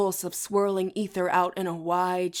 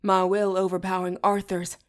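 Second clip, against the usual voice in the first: plain reading voice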